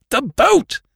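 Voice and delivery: high, loud urgent shout; shaking the dazed captain, high loud shout. A narrator's reading. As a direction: yell it straight into his face. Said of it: "I shouted at him".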